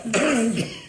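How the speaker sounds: a last dry cough; short cough. Acted. Inspector still choking on the mothball smell, short cough. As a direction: clear the throat with a cough. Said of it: "je supporte mal la naphtaline".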